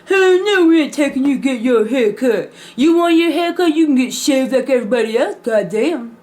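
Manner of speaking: mock angry father yelling no; gruff dad refusal. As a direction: bark it like an annoyed dad. Dad refusing, voiced in story.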